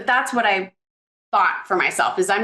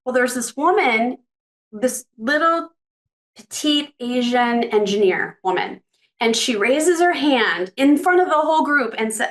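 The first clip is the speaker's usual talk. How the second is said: normal talking voice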